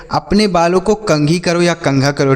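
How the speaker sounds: normal talking voice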